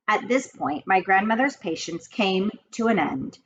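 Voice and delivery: usual speaking voice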